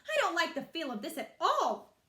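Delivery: loud, high stern mother; firm loud refusal; mother voicing loud distaste; disapproving mother.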